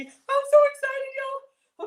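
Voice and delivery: high squealing joy; excited squeal. Real, not acted. Squealing to viewers before the first bite. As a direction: squeal it with delight.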